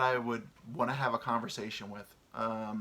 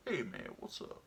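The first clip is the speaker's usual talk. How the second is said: put-on voice; 3 semitones higher, 9 dB quieter than usual. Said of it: "racist voice".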